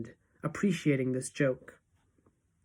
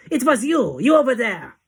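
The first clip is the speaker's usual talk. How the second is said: high, very loud accusation; enraged shriek; 8 semitones higher, 10 dB louder than usual. A narrator's reading. Witch leader accusing the interrupter, furious shriek. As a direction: shriek at the culprit. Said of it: "she shrieked".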